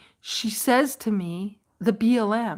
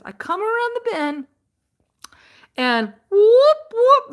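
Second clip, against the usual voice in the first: excited retelling; 9 semitones higher, 4 dB louder than usual. Retold or imitated. Acting out being pulled over.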